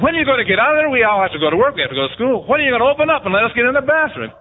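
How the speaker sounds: loud, high, impatient shouting; family yelling at the door. Retold or imitated. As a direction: bang on the bathroom door and holler to get in. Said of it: "everybody's knocking on the door and said".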